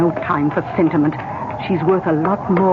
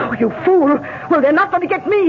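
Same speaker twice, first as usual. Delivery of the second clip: panicked angry shout; 11 semitones higher than usual. Acted. Cornered sister at her brother: panicked angry shout. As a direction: spit it out in desperate rage. Caught killer panics, flees.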